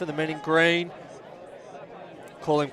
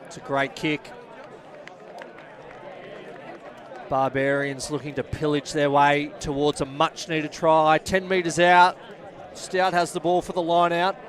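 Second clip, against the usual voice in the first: steady play-by-play. Acted. Live rugby match commentary.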